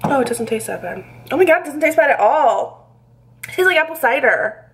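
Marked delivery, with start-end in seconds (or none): 1.3-4.7 higher, pleasantly surprised voice. Real, not acted. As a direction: sound amazed at the taste. Surprised by the taste.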